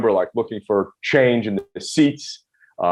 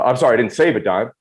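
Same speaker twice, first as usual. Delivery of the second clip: normal talking voice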